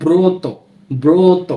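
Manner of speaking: ব্রত said as "broto", o after b; ব্রত with a clear o-sound; model reading. Shown as a demonstration. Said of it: "কি হয়?". (ব্রত শব্দে ও-কারান্ত উচ্চারণ)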